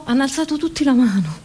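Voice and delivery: usual speaking voice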